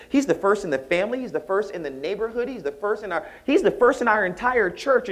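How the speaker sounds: high, excited, building list of firsts; proud boasting. Retold or imitated. Proud mother boasting of her son, excited and high. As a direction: gush with pride, pitched well up.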